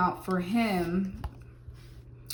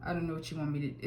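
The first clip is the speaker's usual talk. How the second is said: fed up; 4 semitones lower, 6 dB quieter, much faster than usual. Retold or imitated. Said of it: "throwing her hand, her hands up".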